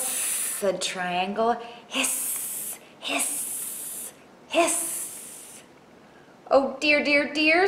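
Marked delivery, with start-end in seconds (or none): 1.9-5.6 three drawn-out hisses; snake hiss. Acted. Triangle keeping up the trick, repeated snake hisses. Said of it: "just like a snake". 6.5-7.7 high, loud fretting; frightened Square. Acted. Square hearing more hisses, frightened fretting. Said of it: "Square was afraid of snakes".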